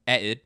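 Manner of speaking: "headed" without the h or first d, loud; Cockney "headed". Shown as a demonstration. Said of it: "They drop the H".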